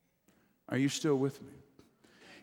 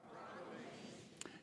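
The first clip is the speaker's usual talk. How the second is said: congregation response from afar; 22 dB quieter, slower than usual. Real, not acted. Congregation repeats word.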